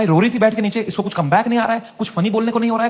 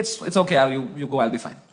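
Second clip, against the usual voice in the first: softly, a touch teary, brushing him off; quiet imitated sob-talk, shooing him away; mild tearful murmur; 9 semitones lower, 6 dB quieter, much slower than usual. Crying guest waving the host off, softly teary.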